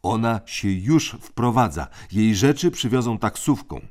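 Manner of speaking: crisp orders from the eldest; bossy older brother. A narrator's reading. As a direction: lay down the rules like a little tyrant. Tim reporting news.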